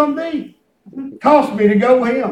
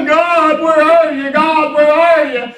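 whining to God; 4 semitones higher, slower than usual. Retold or imitated. A husband mimics himself whining to God in hard times, mock whine. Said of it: "I was in there whining too".

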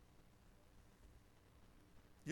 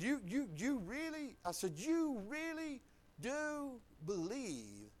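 drawn-out confrontation; 7 semitones higher, slower than usual. Retold or imitated.

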